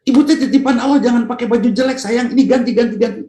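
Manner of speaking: husband praising his wife, voice pitched high; husband doting on wife. Acted. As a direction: pitch way up and fuss over her like a husband.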